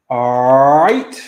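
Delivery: loud, rising all right; called out. Real, not acted. Host calling out all right to the viewers. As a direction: call it out to the stream.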